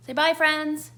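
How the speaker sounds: high, loud cheery call; bright prompt. Real, not acted. Mum prompts her toddler to wave goodbye, high and loud.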